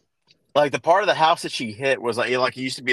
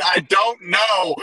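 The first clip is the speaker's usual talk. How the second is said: high, drawn-out exasperated wail; stretched-out mock panic, rising at the end; retold helpless cry; 7 semitones higher, much slower than usual. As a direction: imitate a frantic "no idea", pitched way up. Retold wife's helpless panicked answer.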